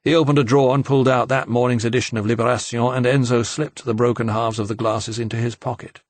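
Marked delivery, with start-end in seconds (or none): none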